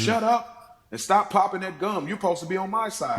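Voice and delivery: high, fast exasperated snap; mock scolding. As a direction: scold her like a fed-up husband. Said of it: "there was a moment he's like".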